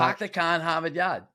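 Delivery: natural Boston accent, R's dropped; Boston "pahk the cah in Hahvahd Yahd". Shown as a demonstration. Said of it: "if I say my R's".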